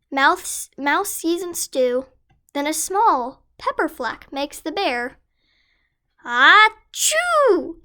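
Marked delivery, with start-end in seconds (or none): 6.3-7.8 loud high acted sneeze; big sneeze. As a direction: sneeze it out hugely. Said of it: "He blows and he sneezes".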